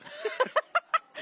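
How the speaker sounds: squealing laugh; high shriek of laughter. Real, not acted. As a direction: burst into a high-pitched laugh. Close-up shot makes them laugh.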